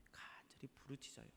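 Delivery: whispered, hushed; hushed prayer. Acted. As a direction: let it fall to an earnest whisper.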